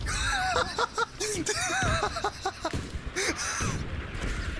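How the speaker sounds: long, high cackling fit; hysterical laugh. Real, not acted. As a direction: laugh helplessly at length. Cracking up at game.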